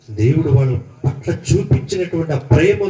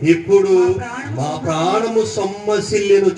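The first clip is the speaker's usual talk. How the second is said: slow, drawn-out recital of a wailed complaint; mock-lamenting scripture reading; 3 semitones higher, slower than usual. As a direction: read it slowly, stretching each craved food as the list climbs. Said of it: "ఏమని ఏడుస్తున్నారంటే". (విలపిస్తున్నట్టు సాగదీస్తూ నెమ్మదిగా చదవడం)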